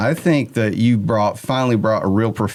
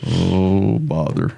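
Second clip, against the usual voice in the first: Eeyore voice; 4 semitones lower, much slower than usual. Pretend. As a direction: sink the words down like a sad stuffed donkey. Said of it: "I sound like Eeyore right now".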